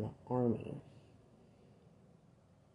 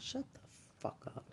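unvoiced, strained hiss; hissed outburst; 14 semitones higher, 9 dB quieter than usual. Real, not acted. Speaker telling accusers to shut up in a hiss. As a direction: force it out without voice.

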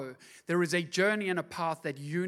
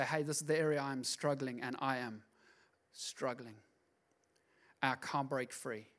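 quiet confession; 5 semitones lower, 8 dB quieter than usual. Pretend. Voiced prayer of struggle.

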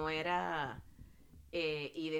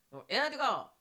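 higher, garbled Southern US drawl; Southern US cashier; 7 semitones higher, 4 dB louder, slower than usual. Retold or imitated. Cashier repeating himself to confused customer, higher garbled Southern accent. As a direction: repeat it slurred in a Virginia accent. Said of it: "no entendía al cajero".

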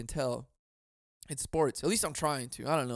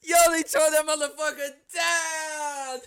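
much higher, louder hyped yell; mock fan shout; 13 semitones higher, 5 dB louder than usual. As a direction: hype it up like a rowdy fan. Acting out rioting Philly fans.

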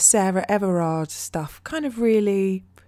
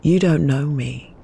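slow, louder spoken-word reading with long pauses; poem reading; 3 semitones lower, 5 dB louder, slower than usual. Acted. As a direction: drop each word into the silence like a weight. Reads her poem over soundscape.

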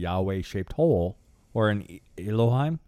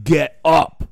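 loud, higher divine shout; booming command; 6 semitones higher, 9 dB louder than usual. Retold or imitated. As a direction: bellow the order like a god. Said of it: "anybody that's heard that voice".

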